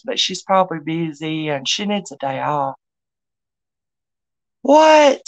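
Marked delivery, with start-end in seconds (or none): 4.6-5.2 sharp, high, loud "what?"; startled outburst; surprised. Real, not acted. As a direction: caught off guard by a comment. Reads a surprising chat comment.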